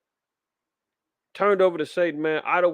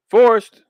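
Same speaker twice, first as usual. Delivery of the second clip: "Forced." barked out loud and high on its own; stressed word; 6 semitones higher, 12 dB louder than usual. Real, not acted. Preacher to his listeners, hitting "Forced." loud and high. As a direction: hammer the word "Forced." hard. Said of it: "Need, need we look at it?".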